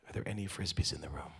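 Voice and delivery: low unvoiced question; whisper. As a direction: therapist quietly asking the wife in a whisper. Therapist's punchline question.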